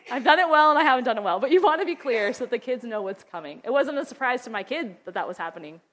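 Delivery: ordinary delivery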